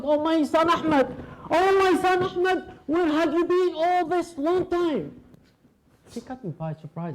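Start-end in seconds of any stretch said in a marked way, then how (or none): 0.0-5.1 put-on high old woman crying out for her son; old woman shouting. Old woman clutching a stranger, high crying out. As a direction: wail like a mother finding a lost child. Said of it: "started hugging me so tight and shouting".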